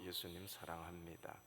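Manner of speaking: ordinary delivery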